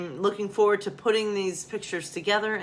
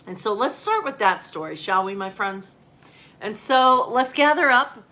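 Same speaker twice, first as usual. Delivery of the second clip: ordinary talk, nothing marked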